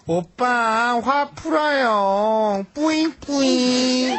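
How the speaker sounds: slow, high cutesy pleading; cutesy aegyo. Shown as a demonstration. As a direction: coax him sweetly like a baby. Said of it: "요 정도 괜찮아요".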